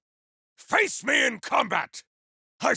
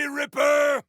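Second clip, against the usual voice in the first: shouted taunt; 4 semitones higher than usual. Acted. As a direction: yell Ripper! with contempt. Taunting the opponent Ripper.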